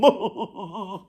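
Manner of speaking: short pleased giggle; the rascal giggles after boasting. Acted. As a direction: giggle at your own boast. Rascal laughs.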